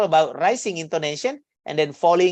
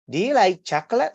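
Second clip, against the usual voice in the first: "Do you like chocolate" higher, lifting toward the end; rising intonation; 4 semitones higher than usual. Shown as a demonstration. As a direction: ask "Do you like chocolate" with a question rise. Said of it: "a rising intonation".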